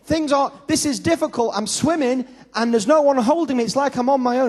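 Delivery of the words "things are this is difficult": ordinary talk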